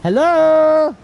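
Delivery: loud, high drawn-out hello; calling out. Real, not acted. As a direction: holler it as if nobody is answering. Calling out loudly to someone.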